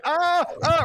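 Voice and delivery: high mock yelps of pain; mock pained cries. A crushed factory worker cries out, mock yelps. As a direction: yelp like the crushed worker, hammed-up.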